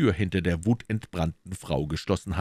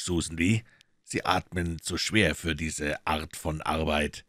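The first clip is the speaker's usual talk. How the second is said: lower, calm, mocking Holmes; cool detective teasing the maid; dry detective; 5 semitones lower than usual. Holmes coolly needling the maid, lower voice.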